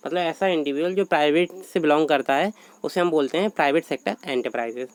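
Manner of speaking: normal talking voice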